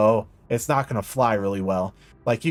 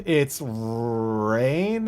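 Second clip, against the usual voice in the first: RAIN drawn out and swept upward; slow upward glide on RAIN; rising tune. Shown as a demonstration. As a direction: stretch RAIN with a climbing pitch. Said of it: "if it goes up".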